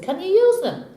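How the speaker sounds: high-pitched questioning barman; barman's question. Acted. Barman asks cowboy about his guns, high questioning. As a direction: ask it high, at full voice.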